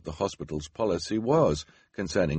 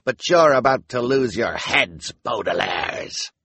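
louder, drawn-out threat that ends in a shout; menacing snarl; 5 semitones higher, 6 dB louder than usual. A narrator's reading. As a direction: spit the name out like a threat. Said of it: "Esme said with a snarl".

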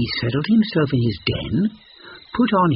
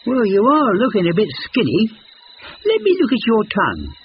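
much higher, gentle monkey voice; kind monkey; 9 semitones higher, 4 dB louder, faster than usual. A narrator's reading. Monkey kindly checking the lion, high and gentle. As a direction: kindly offer to help. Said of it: "said Monkey kindly".

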